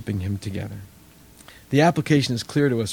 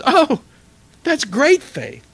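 higher, louder, slow and amazed; delighted praise; 13 semitones higher, 8 dB louder, slower than usual. Acted. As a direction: marvel at her answer out loud. Jesus delighted by the woman's faith.

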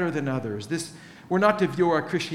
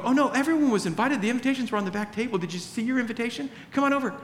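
higher, fast and eager; eager explaining; 5 semitones higher, faster than usual. Pretend. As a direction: rush to reassure them they're invited. Friend eagerly explains invitations.

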